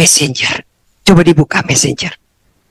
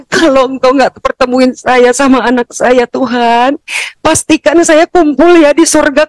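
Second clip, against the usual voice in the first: pleading prayer; 8 semitones higher than usual. Retold or imitated. Mother praying in the cave for her lost son, pleading high and urgent. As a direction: beg God to keep the promise.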